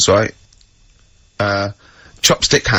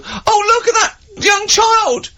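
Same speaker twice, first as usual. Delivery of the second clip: mock crowd cry; slower than usual. Bystanders cry out in frog story.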